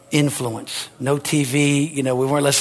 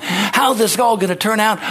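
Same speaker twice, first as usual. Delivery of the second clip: normal talking voice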